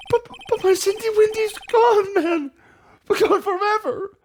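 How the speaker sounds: high, slow mock grief; whiny lament. Larry mourning his girlfriend to the chief, high mock grief. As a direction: wail over lost Cindy Windy.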